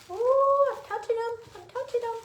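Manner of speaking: high, loud, thrilled squeal; excited squeal. Real, not acted. Unboxer squeals with delight on first touching the nutcracker.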